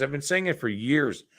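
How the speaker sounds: ordinary delivery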